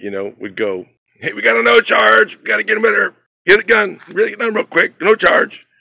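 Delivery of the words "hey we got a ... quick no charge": high, loud shop call; loud announcement. Retold or imitated. Manager calling out the no charge job to the crew, loud. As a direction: bark it across the shop so the customer hears. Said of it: "it was just like this announcement".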